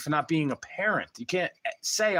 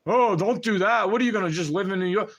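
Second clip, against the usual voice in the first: mock reply; 5 semitones higher, 4 dB louder than usual. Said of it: "I said".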